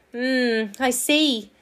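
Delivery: distracted acknowledgement. A narrator's reading. Park keeper not giving the squirrel full attention, distracted. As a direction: answer while half listening. Percy half listening while searching.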